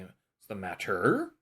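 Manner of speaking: matter with the final "-er" swooping up; "matter" with a drawn-out, rising "-er"; stress on last syllable. Shown as a demonstration. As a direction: wrongly stress the end of "matter". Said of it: "You're not emphasizing the er".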